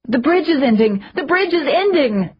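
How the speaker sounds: high, urgent shout. A narrator's reading. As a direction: yell it in alarm. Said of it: "May shouted".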